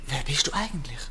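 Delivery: whisper. Acted. Prisoner asks his rescuer secretly.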